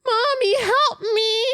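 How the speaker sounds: put-on high, loud little child crying out; baby voice. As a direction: squeak it like a scared baby. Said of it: "the baby's like".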